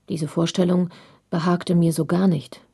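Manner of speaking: plain reading voice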